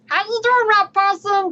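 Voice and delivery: high, loud cartoon alien voice; hammed-up Stitch greeting; Stitch impression. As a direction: squeak it out like Stitch. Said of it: "Just doing my best Stitch impression".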